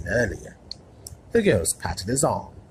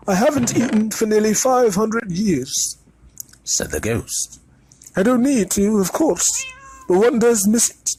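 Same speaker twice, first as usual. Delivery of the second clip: high, loud, genteel ghost voice; courtly and a little wistful; ghost in the ruff; 5 dB louder than usual. A narrator's reading. The ghost introduces himself to Harry, courtly and wistful.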